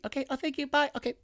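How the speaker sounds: mock timid phone voice; meek caller. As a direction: squeak a polite goodbye. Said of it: "that's how the conversation went".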